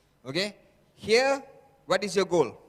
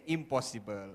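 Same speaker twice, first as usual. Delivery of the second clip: doubting inner voice; 3 semitones lower than usual. Acted. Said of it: "He will convince you and say".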